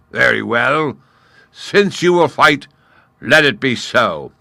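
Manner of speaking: loud, high lion voice; grand, mocking acceptance. A narrator's reading. Lion agreeing to fight the gnat, loud.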